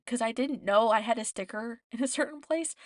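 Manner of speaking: ordinary talk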